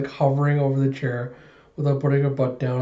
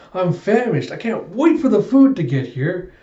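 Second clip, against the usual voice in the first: higher, louder cheerful exclamation; happy eagerness; 6 semitones higher, 5 dB louder than usual. Acted. Woman happy again, waiting for food, eager. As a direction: gush with hungry anticipation.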